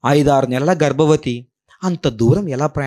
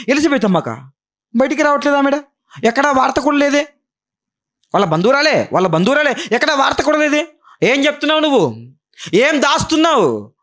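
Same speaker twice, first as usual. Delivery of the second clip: high, insistent preaching; 13 semitones higher than usual. Acted. Preacher asking what Mary hides, high and insistent. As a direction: keep hammering the point.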